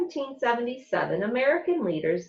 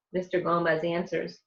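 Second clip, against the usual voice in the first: ordinary delivery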